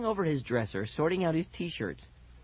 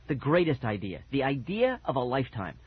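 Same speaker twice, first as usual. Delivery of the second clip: ordinary delivery, nothing marked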